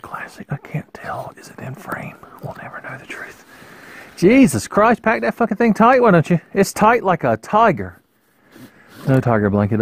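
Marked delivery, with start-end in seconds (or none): 0.0-3.5 soft murmured whisper. Real, not acted. Vlogger filming down his face, whispering. 4.2-8.0 higher, louder frustrated swearing; exasperated outburst. Real, not acted. Fighting the tight packaging, loud exasperated swearing.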